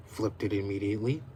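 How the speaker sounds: usual speaking voice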